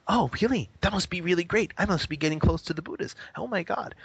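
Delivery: high, hammed-up self-delighted awe; exaggerated, high-pitched imitation of delight; mock flattered student. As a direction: play a student thrilled by the praise. Acts flattered student swallowing the praise.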